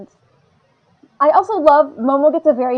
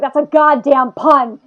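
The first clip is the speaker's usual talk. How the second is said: mildly outraged, louder and slower; retold exasperation, punching GODDAMN; mock anger; 6 dB louder, slower than usual. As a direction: hit it like a joke-angry realization. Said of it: "I got very angry".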